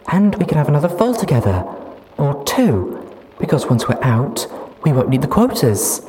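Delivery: put-on high, loud, giddy young mare. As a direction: chatter happily about the future. Duct Tape excited.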